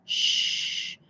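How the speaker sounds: "shh" whispered and stretched; a long gentle "shh"; soft sh. Shown as a demonstration. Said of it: "más suave y se puede estirar". ("shh" suave y estirado)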